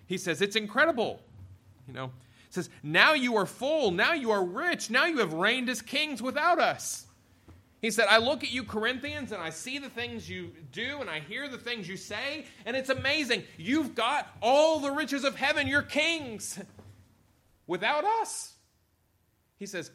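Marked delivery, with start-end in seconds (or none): 0.4-1.2 high, loud mock amazement; sarcastic. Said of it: "he gets very sarcastic". 2.8-7.1 high, loud sarcastic praise. Retold or imitated. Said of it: "he gets very sarcastic". 8.1-18.5 very high-pitched mock amazement; sarcastic. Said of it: "he gets very sarcastic".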